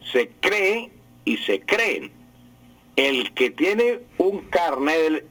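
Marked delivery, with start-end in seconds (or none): 0.1-2.1 cree and creen slowly, stressed on the first E; higher CRÉ-e, CRÉ-en; acento grave. Shown as a demonstration. (cree y creen con acento en la primera e)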